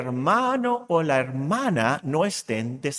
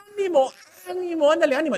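mock cheering; 9 semitones higher, much faster than usual. Mocking empty cheering.

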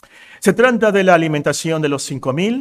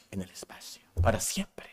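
dramatic whisper; 6 semitones lower, 13 dB quieter than usual. Acted. Astronaut lost in space forever.

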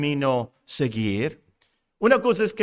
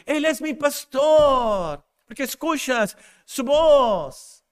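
impassioned preaching; 5 semitones higher than usual. Real, not acted. Preacher urges listeners to know the shepherd's voice, impassioned preaching. Preacher exhorts, building to climax.

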